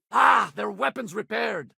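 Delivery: high-pitched, drawn-out alarm; combat warning. Acted. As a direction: warn the crew mid-fight, slowly.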